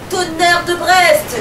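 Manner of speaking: slow, high, loud oath; captain cursing at the storm, slow and loud; captain's roar. Acted. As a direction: bellow it like an old sea dog. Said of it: "hurle le capitaine".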